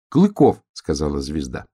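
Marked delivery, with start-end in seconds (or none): 0.1-0.6 high, loud, emphatic star; insistent star. A narrator's reading. Star answering the puzzled donkey, high insistent voice. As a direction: exclaim it high and forcefully.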